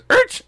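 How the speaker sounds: sudden high, loud screech; sharp vocal skid noise; imitated tire screech. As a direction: mimic brakes screeching in one short burst. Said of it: "all I hear is".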